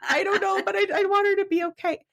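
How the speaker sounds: high, hurried mock-desperate plea; pleading teen. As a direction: rush it out like a frightened kid begging. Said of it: "I'm like".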